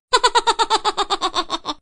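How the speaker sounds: high mock laugh at his own joke; high cackling laugh. Comedian laughs high at his own sheep joke.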